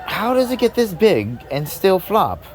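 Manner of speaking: high, drawn-out baffled question; incredulous wonder. Retold or imitated. Comic marveling at the sight to the crowd, mock bafflement.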